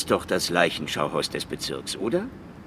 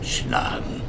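usual speaking voice

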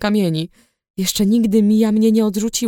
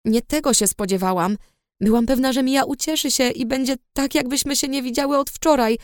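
usual speaking voice

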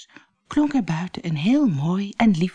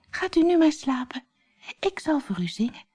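nightingale's voice; 9 semitones higher than usual. Acted. Nightingale sings the emperor to sleep, high and gentle. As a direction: soothe him gently as the small bird.

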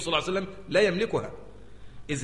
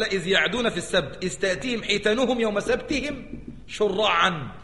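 Quran recitation; 4 semitones higher than usual. Shown as a demonstration.

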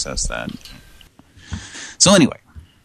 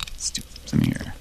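whisper; 9 dB quieter, faster than usual. Real, not acted. Whispered aside.

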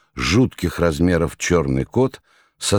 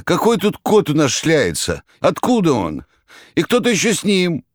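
frightened shout; 11 semitones higher than usual. Acted. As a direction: cry out in alarm. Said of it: "испуганно закричал".

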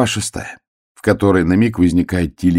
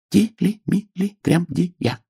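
high, slow syllable-by-syllable reading; по складам; 12 semitones higher, much slower than usual. A narrator's reading. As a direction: sound out each syllable in a small high voice. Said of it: "ежик по складам прочитал". (тонким голосом по складам)

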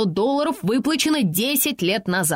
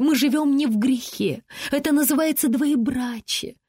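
high-pitched indignant correction; 5 semitones higher than usual. A narrator's reading. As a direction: protest in a raised pitch. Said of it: "возмутилась Сисси". (возмущённо, высоким голосом)

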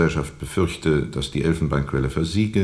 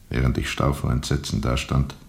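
usual speaking voice

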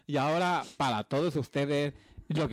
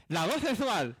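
higher, teasing sexy voice; sultry voice; 6 semitones higher, slower than usual. Pretend. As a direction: purr it like a seductive host.

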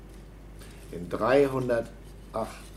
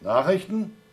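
surprised; 8 semitones higher, 5 dB louder than usual. Acted. Surprised at news offer.

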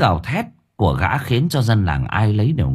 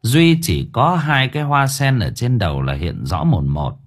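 ordinary delivery, nothing marked

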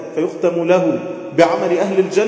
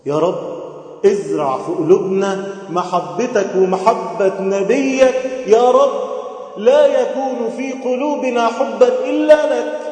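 slow, higher, rising supplication; fervent prayer; 6 semitones higher, slower than usual. Acted. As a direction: plead with God, voice lifted. Closing prayer. (دعاء متضرع بطيء بصوت مرتفع)

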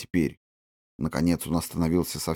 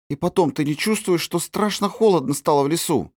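put-on worried little girl; 9 semitones higher than usual. Girl voiced in story.

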